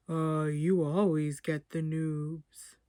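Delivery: slow, drifting, amused voice. Acted. Chuck teases Damien from behind, slow and dreamy. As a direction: drawl it out half asleep. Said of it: "A deeper voice chuckled".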